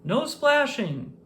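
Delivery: high, slow stern call; strict lifeguard shouting. A narrator's reading. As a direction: call the rule out from the tower. Lifeguard scolding again.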